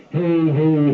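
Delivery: fuzzy distorted mic; fuzz distortion. Shown as a demonstration. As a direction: voice through a fuzz pedal. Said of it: "you can hear that fuzz happening".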